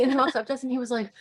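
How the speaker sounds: ordinary delivery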